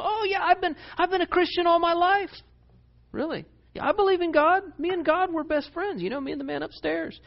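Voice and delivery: high, mock-eager voice of a fake believer. Preacher mocking a fake believer, high and smarmy. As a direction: ham up a smarmy churchgoer. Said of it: "They go".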